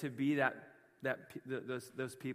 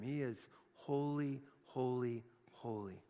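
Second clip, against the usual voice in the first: reverent hush; 5 semitones lower, 8 dB quieter than usual. Acted. Reverent fading repetition.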